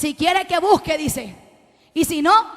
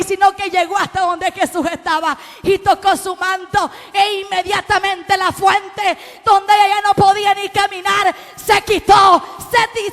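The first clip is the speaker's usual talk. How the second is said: loud, rising preacher's cry; loud preaching build; full-force preaching; 5 semitones higher, 6 dB louder than usual. Acted. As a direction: preach it out to the church.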